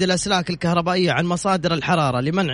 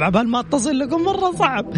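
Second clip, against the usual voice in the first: ordinary talk, nothing marked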